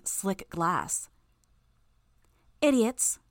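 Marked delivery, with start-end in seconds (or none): 2.6-3.2 high, loud scornful jeer. A narrator's reading. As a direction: sneer it down at them. Invincible hero mocks from cloud.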